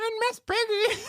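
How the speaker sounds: very high failed Miss Piggy voice; a cracked, high Miss Piggy try; Miss Piggy impression. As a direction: attempt a diva pig voice way up high. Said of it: "that was supposed to be Miss Piggy".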